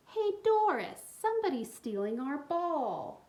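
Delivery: put-on high, loud boy's call. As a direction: yell across the park to Doris. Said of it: "a boy's voice rang out".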